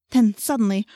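ordinary delivery, nothing marked

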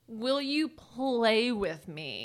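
each word drawn out slowly; slow and deliberate. Retold or imitated. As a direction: spell it out for a deaf old man. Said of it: "One more time".

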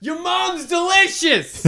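high, slow heckle through laughter; laughing heckle. Retold or imitated. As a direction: taunt him while cracking up. Heckling player from the stands.

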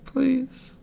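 high, soft mock-tearful squeak; imitating tearful plea. Fan quoting Madison in the sad part, mock tearful. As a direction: plead in a tiny put-on crying voice. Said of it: "'cause he's crying".

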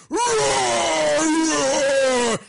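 loud, high mock roar; imitated growl from the stage. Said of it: "He's like".

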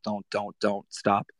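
an urgent hushed plea to himself; telling himself to stop. Retold or imitated. Mid-rant at the client, hushed self-warning to stop. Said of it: "I'm saying to myself".

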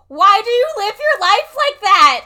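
loud, high, laughing disbelief; exasperated outburst. Retold or imitated. Mocking a movie character's diet, a laughing high shout. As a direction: shriek it through a laugh. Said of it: "And I was like".

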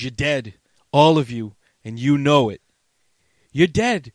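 half-acted gunpoint threat; mock threat. Luther threatening the Warriors, mock menace.

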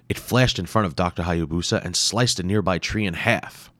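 ordinary delivery, nothing marked

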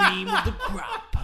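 high, loud, laughing mock promo voice; laughing Macho impression. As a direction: belt it out through laughter like a wrestler.

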